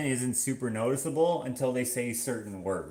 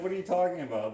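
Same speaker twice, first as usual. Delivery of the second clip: higher-pitched quote of a puzzled grandfather; imitated grandfather; 8 semitones higher, much faster than usual. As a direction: mimic a Canadian grandpa's baffled reply. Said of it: "He'd be like".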